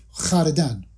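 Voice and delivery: خردن said as "khʷardan"; rounded kh straight into "a" in خردن; old pronunciation. Shown as a demonstration. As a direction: demonstrate old-style "khʷar-dan" for خردن. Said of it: "اگر با تلفظ قدیم بخوایم تلفظ کنیم".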